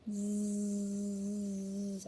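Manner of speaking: long steady buzzing zzz; drawn-out snore letter, held on one note; imitated snoring. A narrator's reading. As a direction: buzz like a storybook sleeper. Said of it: "like this".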